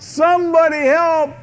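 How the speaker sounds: high, loud cry for help; terrified cry. Acted. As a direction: scream for help from the dark. Terrified people in a dark car.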